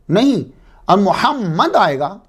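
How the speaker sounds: محمد as mu-ham-mad with a doubled m, slow; محمد with the mim held double; correct Arabic form. Shown as a demonstration. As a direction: slowly give the proper Arabic محمد. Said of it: "یہ ہے مفعول".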